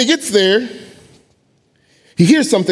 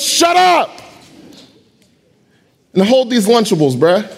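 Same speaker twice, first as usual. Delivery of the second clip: sassy David; 4 semitones higher than usual. Acted. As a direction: snap back with swagger. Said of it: "He goes".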